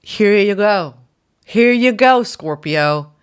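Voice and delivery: high, loud, joyful giving voice; voicing Source. Source gifting the Scorpio listener, high and loud. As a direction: beam it out like a generous universe. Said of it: "And then Source just says".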